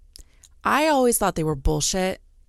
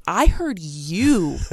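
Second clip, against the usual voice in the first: slow, louder, drawn-out "you"; insistent stress; 3 semitones higher, 5 dB louder, much slower than usual. Retold or imitated. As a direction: insist on it, stretching "you" right out. Retold insistence to husband.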